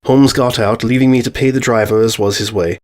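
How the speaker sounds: plain reading voice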